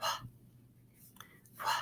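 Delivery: very short, voiceless "wah", repeated with gaps; breathless sound. Shown as a demonstration. Teacher showing pupils how you just kinda do it, breathless sound. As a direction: puff out each "wah" almost without voice. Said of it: "It's called a breathless sound".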